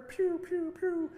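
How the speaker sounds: high-pitched mock laser zaps; imitated laser shots. Preacher retelling Star Wars lasers shooting, playful imitation. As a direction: make little high pew noises like movie blasters. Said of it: "lasers are shooting everywhere".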